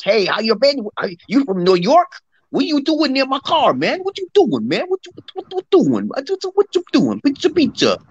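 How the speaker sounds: high-pitched, exaggerated New York accent; New York accent impression. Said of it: "everyone sounds like this".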